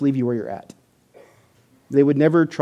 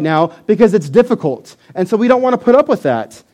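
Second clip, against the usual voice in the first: heated resistance; 9 semitones higher, 7 dB louder than usual. Shown as a demonstration. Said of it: "acting out resistance".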